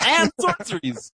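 slow squeaky high tag-on; mock high squeak. Finishing the joke about card types, slow squeaky mock voice.

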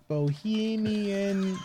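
drawn out while reading; slow reading aloud. Real, not acted. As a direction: stretch the word slowly. Slowly reading a search.

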